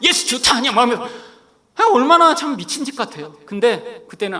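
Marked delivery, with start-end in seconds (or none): 0.0-0.6 retold shouting. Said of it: "막 소리 지르고 싸우면서".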